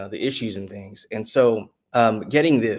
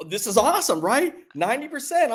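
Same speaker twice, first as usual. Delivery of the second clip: thrilled; 13 semitones higher than usual. Retold or imitated. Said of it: "you know, like".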